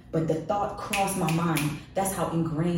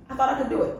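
said through a laugh, higher and louder; rueful laughing repeat; 8 semitones higher, 4 dB louder, faster than usual. Real, not acted. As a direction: laugh the words out at yourself. Repeats line, second time laughing.

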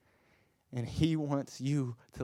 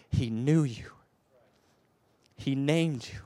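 usual speaking voice

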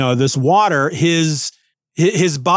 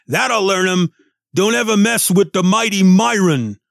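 put-on cocky Brooklyn-accented robot boast; Myron; 5 semitones higher than usual. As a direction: strut it like a pint-sized tough guy. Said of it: "where we learn the robot's name".

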